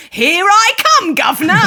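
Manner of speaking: put-on a cheesy English accent on cue; mock English accent. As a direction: ham up a cockney English accent. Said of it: "the impulse to be like".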